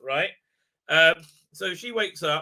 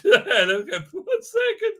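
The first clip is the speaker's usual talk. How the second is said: high, slow words through laughter; real laughing; 10 semitones higher, 4 dB louder than usual. Real, not acted. Reviewer cracking up at a slide worth keeping, real helpless laughing. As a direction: squeeze it out while cracking up.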